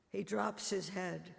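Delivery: usual speaking voice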